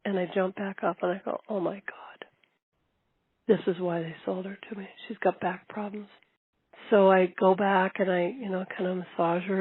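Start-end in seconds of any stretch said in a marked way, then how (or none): 1.5-6.1 hushed, fast worried whisper; panicked whisper to herself; frightened whisper. Retold or imitated. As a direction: whisper it in sudden dread. Panicked realization after horse falls.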